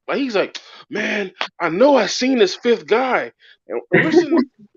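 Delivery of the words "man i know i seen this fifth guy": high, drawn-out insistence; tripping-out cop. Retold or imitated. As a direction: imitate a freaked-out cop, slowly insisting. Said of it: "this dude is, like, tripping out".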